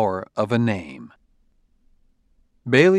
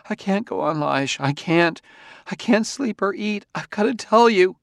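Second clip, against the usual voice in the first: high-pitched distraught woman, acted tears; tearful sobbing; 10 semitones higher than usual. A narrator's reading. Distraught wife starting to confess to her husband, tearful. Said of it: "through a sudden torrent of sobs".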